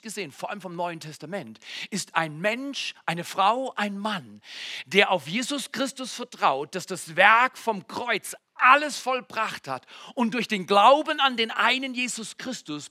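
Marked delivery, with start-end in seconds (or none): none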